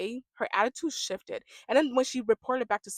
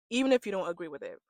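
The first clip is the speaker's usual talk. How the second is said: ordinary talk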